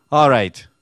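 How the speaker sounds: Krishna's reply. Acted. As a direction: boom out the pardon.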